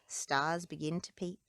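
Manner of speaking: usual speaking voice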